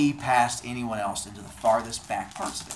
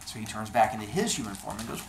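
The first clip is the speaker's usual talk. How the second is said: ordinary delivery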